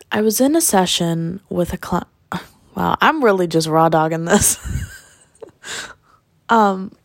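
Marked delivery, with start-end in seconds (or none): none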